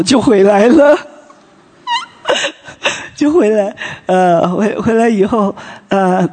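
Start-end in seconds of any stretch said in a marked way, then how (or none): 1.9-2.5 a quick high laugh at the surprise; short laugh. Real, not acted. Laughing at how the husband simply agreed to come back. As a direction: laugh it off lightly.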